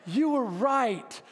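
slow, drawn-out repeat of her admission; repeated concession. Retold or imitated. Wife repeats her admission to husband on request, drawn out. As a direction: retell the admission again, stretching each word. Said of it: "Please say that again".

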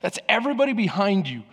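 usual speaking voice

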